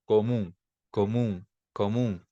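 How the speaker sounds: comum slow with a nasal end, no n; Portuguese pronunciation. Shown as a demonstration. Teacher asking students to listen to Portuguese comum. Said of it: "Prestem atenção".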